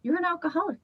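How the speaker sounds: lightly voiced inner voice telling her; hint of a ghostly inner voice. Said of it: "heard a voice as I was driving".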